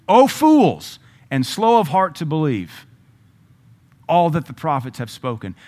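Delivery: loud, high rebuke, quoting Jesus; Jesus rebuking. Retold or imitated. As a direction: shout it as Jesus scolding his disciples. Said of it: "this is Jesus".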